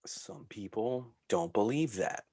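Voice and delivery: normal talking voice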